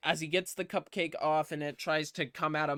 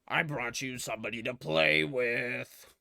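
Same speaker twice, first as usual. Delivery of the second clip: hammed-up villain; 2 semitones lower than usual. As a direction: gloat like a theatrical villain. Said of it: "He has a villain monologue".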